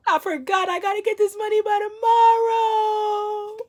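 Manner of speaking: high laughing mock voice of her own panic. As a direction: laugh it out in a squeaky self-mocking voice.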